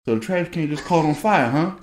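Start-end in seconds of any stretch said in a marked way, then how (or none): none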